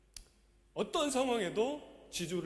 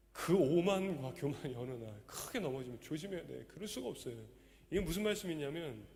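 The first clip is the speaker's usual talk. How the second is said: usual speaking voice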